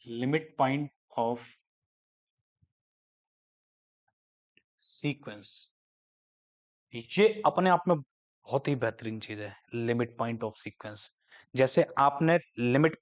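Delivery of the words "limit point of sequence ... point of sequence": slow, word-by-word heading read for notes; slow dictation. Shown as a demonstration. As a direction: spell the heading out for the class. (शीर्षक धीरे-धीरे लिखवाते हुए)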